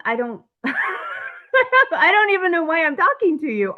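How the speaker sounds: high, laughing retold protest; bewildered laughing. Client answering the criminal lawyer, bewildered and laughing. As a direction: laugh helplessly through the words, pitch climbing.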